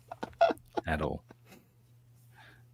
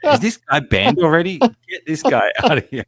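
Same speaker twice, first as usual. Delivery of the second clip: mock outrage; 10 semitones higher, 8 dB louder than usual. Mock banning a chat commenter.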